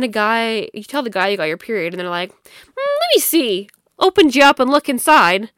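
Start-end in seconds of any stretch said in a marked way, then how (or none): 2.3-3.7 high, slow mock guy; drawn-out high "let me see"; curious guy voice.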